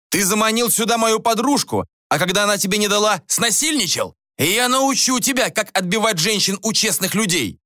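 high-pitched, raging tirade; acted shouting threat; furious accusation. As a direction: lay into the rival angrily. Enraged man accuses and threatens rival.

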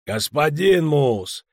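slow, loud, demanding; indignant correction. Acted. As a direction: insist on the title. Official demands his title.

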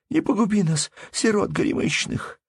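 very high, tearful begging; sobbing plea. A narrator's reading. Peasant woman begging steward to spare orphans, sobbing. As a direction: plead desperately for mercy. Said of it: "рыдая и орошая".

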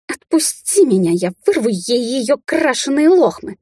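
high, slow, straining with rage; raging rasp. A narrator's reading. She-wolf held back, threatens her rival, raging. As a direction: snarl it high, drawing the words out. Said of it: "прохрипела волчица".

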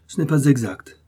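a touch of a pleased father's grumble; grumbling, but secretly charmed; mock grumble. A narrator's reading. Prince grumbles at his daughter, a mild mock scold. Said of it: "gronda le prince".

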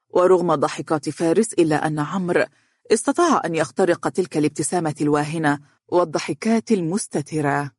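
usual speaking voice

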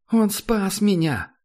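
put-on high, slow tearful girl's cry; tearful plea. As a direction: insist through tears, high. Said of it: "В глазах Несси Джой стояли слёзы". (высокий голос сквозь слёзы)

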